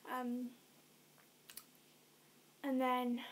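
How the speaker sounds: ordinary delivery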